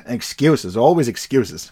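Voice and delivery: ordinary talk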